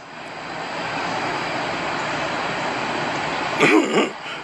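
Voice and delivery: quiet wheezing laughter; airy laugh; long breathy laugh. Real, not acted. Long laugh after rant.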